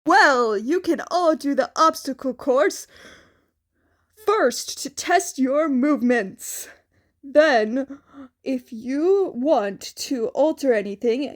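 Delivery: All Might offering costume forms, loud and hearty. A narrator's reading. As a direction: project like a cheerful hero teacher. All Might explains course.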